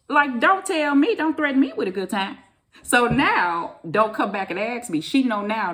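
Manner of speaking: sassy firm warning; emphatic. Real, not acted. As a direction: lay down the law.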